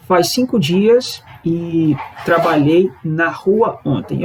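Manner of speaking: slow, measured reading of the translation; slow, careful translation read. Shown as a demonstration. Teacher reading the Portuguese translation to learners, slowly. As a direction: spell the sentence out slowly for students. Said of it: "a tradução ficaria assim".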